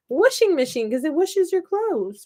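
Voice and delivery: high-pitched "Washing" as whooshing; childhood whooshing machine. Retold or imitated. Crafter voices herself as a kid, high "Washing" as whooshing. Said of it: "when I was a kid".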